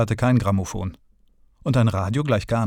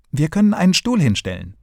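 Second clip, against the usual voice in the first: eager higher, louder call; boy calling out his idea, higher and louder; 7 semitones higher, 4 dB louder than usual. A narrator's reading. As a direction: blurt out the idea with excitement. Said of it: "rief er dann".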